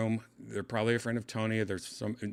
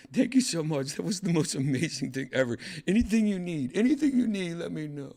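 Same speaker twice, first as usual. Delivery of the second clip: high-pitched imitation of an overjoyed fan; acted-out starstruck gratitude; gushing thanks; 8 semitones higher than usual. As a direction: gush it, climbing higher on each offer. Retold gushing thanks from starstruck woman.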